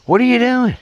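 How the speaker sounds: high-pitched questioning call; high, incredulous mimicked question; mock holler. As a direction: imitate a puzzled coworker calling over. Coworkers calling to him, retold.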